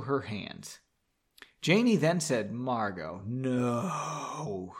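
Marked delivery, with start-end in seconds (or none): 2.5-4.8 low, drawn-out "no"; slow disapproval. A narrator's reading. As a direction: stretch it out slowly, soft and low. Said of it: "slowly, shaking her head".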